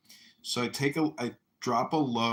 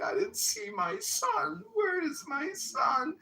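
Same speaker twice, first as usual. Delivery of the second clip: high, slow mock-tearful wail; imitated crying woman; slower than usual. Mother searches for her son after graduation, mock crying. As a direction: imitate a distressed mother wailing, drawn out. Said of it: "there's a woman crying in the stands".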